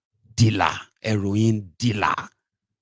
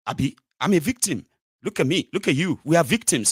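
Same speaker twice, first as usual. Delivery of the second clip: mock victim plea; 6 semitones higher, faster than usual.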